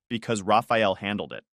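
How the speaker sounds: usual speaking voice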